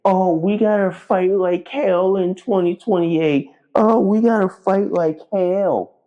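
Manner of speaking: high, whiny mock of complainers; mocking whine. Mocking online whiners who waited too long, high and whiny. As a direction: sneer it like a whiny poster online. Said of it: "whine on social media saying".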